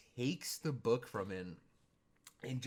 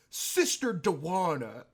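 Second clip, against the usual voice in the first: slow, high, loud churchy Sister Dewana; mock preachy greeting; 6 semitones higher, 9 dB louder than usual. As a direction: stretch it out like a solemn monk. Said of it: "And he goes".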